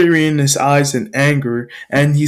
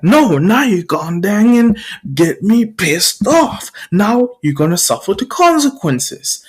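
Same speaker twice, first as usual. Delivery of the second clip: angry bully threat; 6 semitones higher than usual. Acted.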